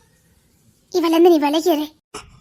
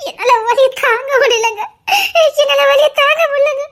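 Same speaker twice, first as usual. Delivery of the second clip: high, tearful cries of pain; crying in pain; 8 semitones higher, 6 dB louder than usual. Acted. Wife in labour begging her husband, crying in pain. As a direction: sob out the words through labour pain.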